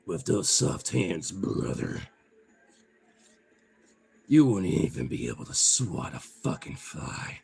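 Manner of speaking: mock tough guy. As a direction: tease like a lightly played macho brother. Taunting viewer in mock tough voice.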